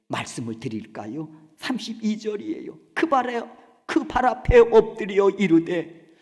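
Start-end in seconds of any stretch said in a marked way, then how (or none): 2.9-6.1 loud, high crying plea at his feet; wailing lament. Acted. Mary weeping at Jesus' feet, loud wail. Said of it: "하면서 막 우는 거예요".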